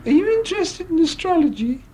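very high, silly showgirl voice; squeaky put-on stage patter; Amy MacDonald. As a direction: chirp to the audience in comic falsetto. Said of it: "say things to the audience like".

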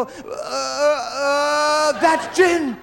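drawn-out rising moans turned into words; squeal, then blurt it as a cover. Retold or imitated. Father covering up his squeals in bed, squealing. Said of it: "my father would start squealing".

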